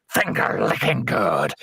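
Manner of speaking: slow, sly villain quip; slow menacing one-liner. Retold or imitated. Killer after biting off fingers, slow gloating quip. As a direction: savour it like a killer's punchline. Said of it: "he's like".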